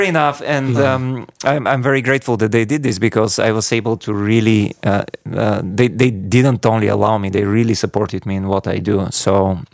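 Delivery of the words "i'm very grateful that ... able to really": ordinary delivery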